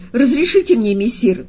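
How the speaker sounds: high, loud eager burst; joyful cry. A narrator's reading. The cat begs messire to let him go, joyful cry. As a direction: cry out gleefully, starting high. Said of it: "вскричал радостно кот".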